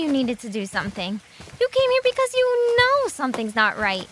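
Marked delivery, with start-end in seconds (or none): 1.6-4.1 urgent plea. Acted. Urging friend not to give up.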